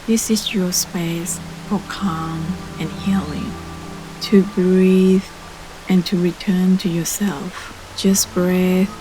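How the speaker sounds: ordinary talk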